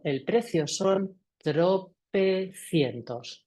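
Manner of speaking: deliberate quote, "tropecientos" split into drawn-out syllables; stop, then give each syllable of "tropecientos" its time; very slow, emphatic demo of stating the price; slow, weighty price. Shown as a demonstration. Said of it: "si yo paro, miro y digo".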